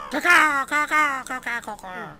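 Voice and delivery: high loud bird squawks, fading at the end; crow cawing. Acted. Crow companion cawing back at the fairy, loud then fading.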